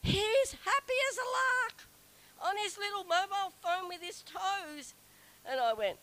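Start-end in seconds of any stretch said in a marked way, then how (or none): 0.0-4.9 marvelling. Retold or imitated. Marvelling at the man.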